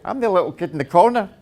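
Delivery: ordinary delivery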